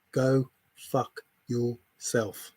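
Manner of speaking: very slow, word-by-word contempt; deliberate insult. Real, not acted. Streamer dismissing an accusing viewer, slow deliberate anger. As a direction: space out each word with cold anger.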